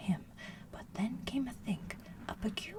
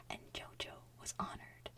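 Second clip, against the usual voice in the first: usual speaking voice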